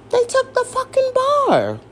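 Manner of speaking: indignant high squeal. Real, not acted. Indignant rant at the lawyers.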